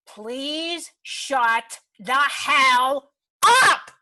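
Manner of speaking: very slow, each word punched, building loud; exasperated outburst. Acted. Woman telling off her critics, exasperated. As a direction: spell it out forcefully to a loud final word.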